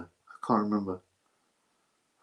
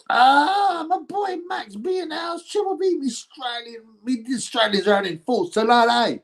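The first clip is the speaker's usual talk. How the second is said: much higher, squeaky put-on voice; silly chat voice; 13 semitones higher than usual. Streamer reading a fan's shout-out to viewers, in a squeaky cartoon voice. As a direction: read the fan's message like a cartoon mascot.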